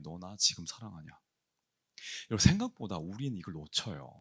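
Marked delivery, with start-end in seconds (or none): 0.0-1.1 softer, lower hushed question; a quiet voice inside; hushed inner voice. Retold or imitated. Jesus's question heard at dawn, hushed.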